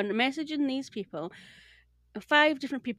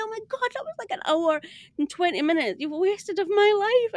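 much higher, indignant complaint; indignant outburst; 9 semitones higher, 4 dB louder than usual. Retold or imitated. Customer to support after the refund, high indignant outburst. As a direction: squeal it out, fed up.

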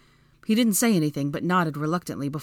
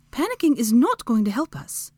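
high, insistent reprimand; stern order; 8 semitones higher, 4 dB louder than usual. A narrator's reading. Hermione to panicking Draco, sternly ordering. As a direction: press the point in a high voice. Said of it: "Hermione ordered".

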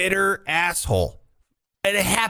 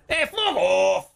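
drawn-out put-on accent, pitched up; [name]; 4 semitones higher, slower than usual. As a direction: dismiss them slowly in a dubious singer's accent. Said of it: "what fucking accent I'm doing".

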